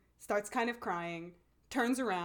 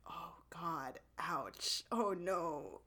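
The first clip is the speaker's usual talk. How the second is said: mock hushed dismay; 3 semitones lower, 5 dB quieter, much slower than usual. Feeling the emotional moment.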